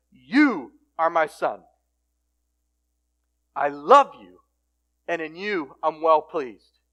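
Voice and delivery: slow, loud divine declaration; solemn proclamation. Retold or imitated. God to Jesus at his baptism, solemn proclamation. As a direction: proclaim it with weight, word by word.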